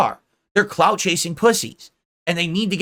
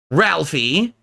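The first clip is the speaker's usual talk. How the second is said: ordinary delivery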